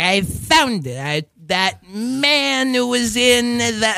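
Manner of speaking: slow, drawn-out searching voice; mom trying to remember. Retold or imitated. Son imitating his mom remembering, slow stretched voice. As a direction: imitate a mom groping for the memory. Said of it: "like if she's trying to remember something".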